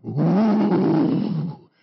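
loud, drawn-out swooning whoop; swooning "ooh". Retold or imitated. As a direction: imitate a lady losing her mind with a loud "ooh". Woman swooning over handsome passenger.

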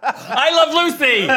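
laughing. Real, not acted.

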